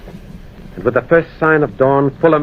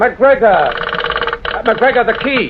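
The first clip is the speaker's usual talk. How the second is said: alarmed shout; 10 semitones higher, much slower than usual. Acted. Alarm as friend runs out.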